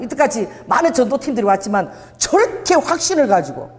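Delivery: ordinary delivery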